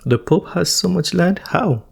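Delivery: high-pitched disbelieving question; amazed at what was just heard; incredulous surprise. Real, not acted. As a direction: ask it in high, baffled amazement. Reacting in disbelief to the video.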